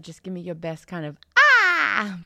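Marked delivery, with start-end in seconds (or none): 1.4-2.2 high, loud bird cry; twangy squawk like a bird; piercing bird call. Shown as a demonstration. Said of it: "one more is to be a bird".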